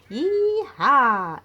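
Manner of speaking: loud high yee-haw; cowgirl whoop. Acted.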